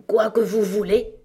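a hint of a hoarse frog, not whispered; hoarse old frog. A narrator's reading. Old frog asks Alice what she wants, lightly hoarse. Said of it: "murmura la grenouille d'une voix grave et enrouée".

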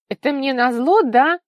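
high, loud, plaintive accusation; whiny, tearful. A narrator's reading. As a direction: whine it as a tearful reproach. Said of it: "неожиданно плаксиво проговорила Вика". (плаксиво, высоким громким голосом)

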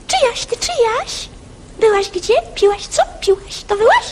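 high bird calls; lapwing calling. Acted. Lapwing calling to the other birds, high. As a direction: call it out like a lapwing. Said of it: "Czajka woła".

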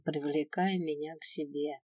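ordinary delivery, nothing marked

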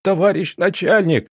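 high, desperate squeal of the title; shrieking plea. Acted. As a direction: squeal it out on your knees. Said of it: "заверещал Федя".

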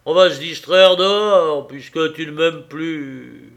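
loud resentful husband; grumbling. Acted. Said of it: "grogna-t-il".

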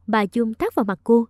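plain reading voice

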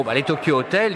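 usual speaking voice

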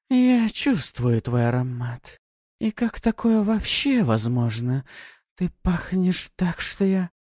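slow, high, sultry; seductive demon. Acted. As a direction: drawl it out to tempt. Seductive succubus speaks.

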